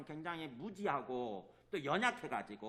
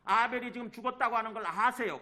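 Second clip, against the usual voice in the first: usual speaking voice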